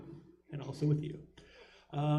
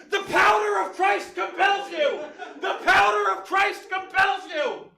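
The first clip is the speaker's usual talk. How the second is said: high, booming exorcist cry; exorcist shout; 9 dB louder than usual. Acted. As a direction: bellow it like an exorcist.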